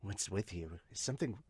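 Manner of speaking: low, soft character voice; quiet question. Acted. Pitcher questioned on the field, low quiet voice. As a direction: ask it low and under-projected. First take, before spotting coach speaks.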